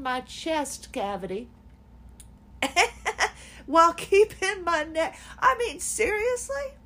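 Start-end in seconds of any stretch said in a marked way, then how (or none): 5.4-6.7 high incredulous "seriously"; exasperated disbelief. Real, not acted. Venting about the therapist, high exasperated disbelief. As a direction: throw up your hands in disbelief.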